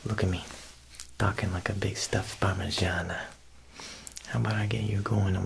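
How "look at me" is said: usual speaking voice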